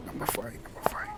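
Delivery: whispered to himself; whispering. Acted. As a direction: whisper it while searching. Muttering while searching seats.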